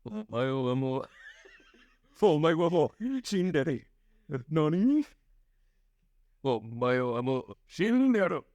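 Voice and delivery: deadpan anime voice, Japanese words; mock anime voice in Japanese; anime villain line. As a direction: do the anime "you're already dead" line. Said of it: "Japanese Ringo Starr".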